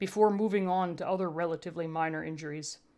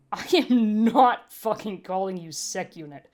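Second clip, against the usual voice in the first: sharp, louder retort; snapped; 6 dB louder than usual. A narrator's reading. Medtech refuses to call the sec unit by its designation, snapping loudly. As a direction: bite the words off, refusing flatly. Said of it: "the human snapped".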